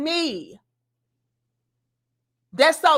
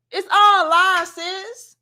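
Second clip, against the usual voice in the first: slow drawn out; 5 semitones higher, 4 dB louder, slower than usual. Real, not acted. Repeating it to Bella.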